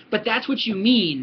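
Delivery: ordinary delivery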